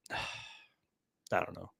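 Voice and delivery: slow, soft sigh of resignation; resigned sigh. Real, not acted. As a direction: let it fade out in defeat. Sighs in resignation.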